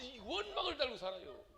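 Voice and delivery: ordinary talk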